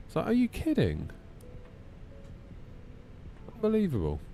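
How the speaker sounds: exasperated disbelief; outraged incredulity; incredulous. Real, not acted. Venting about TikTok.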